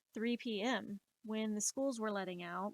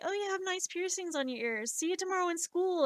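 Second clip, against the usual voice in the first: put-on cheerful kid; 8 semitones higher, 3 dB louder, faster than usual. Kid's voice retold.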